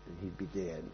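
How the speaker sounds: usual speaking voice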